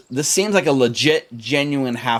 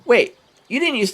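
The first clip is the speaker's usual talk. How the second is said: put-on halfling child; 12 semitones higher than usual. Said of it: "and she says".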